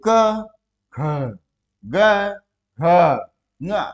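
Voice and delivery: each consonant said alone, slow and spaced; ka, kha, ga, gha, nga with pauses, each letter apart. Shown as a demonstration. Teacher sounding out letters for students, slow and spaced. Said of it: "आप second में उसको divide कर दीजिए".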